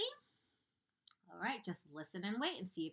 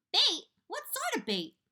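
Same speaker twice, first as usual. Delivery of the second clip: alarmed; 9 dB louder than usual. Acted. Earthworm alarmed at bait.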